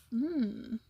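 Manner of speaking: ordinary delivery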